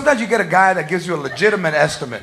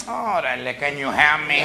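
low, soft Chicano worker mumble; 7 semitones lower, 7 dB quieter than usual. Pretend. Home Depot worker lazily answers a customer, low and mumbled.

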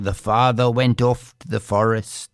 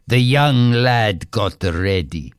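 ordinary delivery

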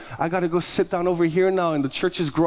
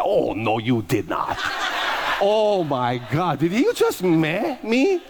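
mock offended sass; 4 semitones higher, much slower than usual. Pastor mocking a congregant's eye roll, sassy outrage. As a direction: play scandalized, stretching every word.

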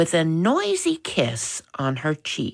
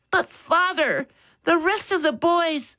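put-on much higher, pleading boy's voice; protesting boy; 13 semitones higher than usual. A narrator's reading. Son arguing with father about moving, high protest. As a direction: protest in a high voice, cut off mid-thought.

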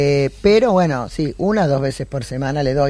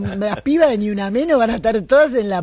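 higher and higher, breaking into laughter; exuberant bravado; 8 semitones higher than usual. Real, not acted. Cartoonist jokes about going to jail, exuberant bravado.

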